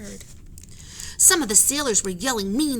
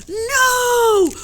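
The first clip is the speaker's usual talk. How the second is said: high, very loud roar; 12 dB louder than usual. Acted. Jack roars at the captain, high and very loud. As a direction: roar your refusal. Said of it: "roared Jack".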